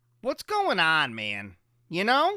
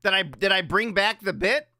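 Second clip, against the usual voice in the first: ordinary talk, nothing marked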